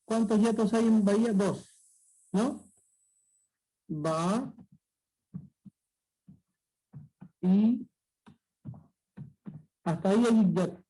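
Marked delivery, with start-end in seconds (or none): 3.9-7.9 "Ba, y" split by long pauses; syllable by syllable. Shown as a demonstration. Teacher splitting bahía for pupils, syllable by syllable. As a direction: say "Ba", wait, then "y". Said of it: "la I se ha separado de la A".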